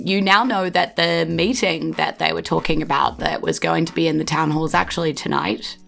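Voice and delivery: ordinary talk, nothing marked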